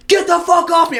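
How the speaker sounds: high yelled get off me; imitated shout. Pinned guy screams at friend, high mock yell. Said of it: "He's like".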